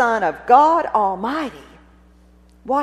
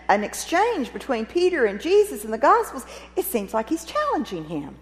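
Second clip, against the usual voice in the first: usual speaking voice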